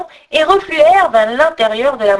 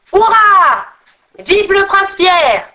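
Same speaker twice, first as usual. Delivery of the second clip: high, loud drawn-out acclamation; slow, exultant high cheer; cheering trolls; 8 semitones higher, 6 dB louder, slower than usual. Acted. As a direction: hail the prince like a whole crowd. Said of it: "les trolls l'acclamèrent".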